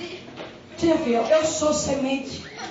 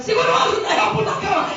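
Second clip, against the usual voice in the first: retold yell; 6 semitones higher, 4 dB louder than usual. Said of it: "Ela grita de lá".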